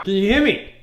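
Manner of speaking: high, loud call; shouted hearing check. Shown as a demonstration. Coach checking if the player hears, shouting. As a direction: holler to get through blocked ears.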